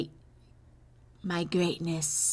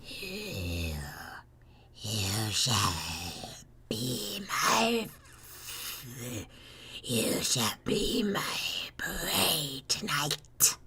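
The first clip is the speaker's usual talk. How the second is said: very low, then a menacing whisper; creepy psycho; 7 semitones lower than usual. Pretend. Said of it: "Creepy Psycho in the basement".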